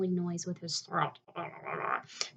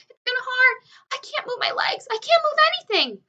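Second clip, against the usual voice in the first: high, loud despairing whine; wailing; 13 dB louder than usual. A narrator's reading. Centipede wails that he cannot move. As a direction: wail that you're stuck.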